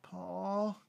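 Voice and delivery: drowsy voice from down the hall; groggy. A narrator's reading. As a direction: call out softly as if just woken. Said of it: "came a groggy voice".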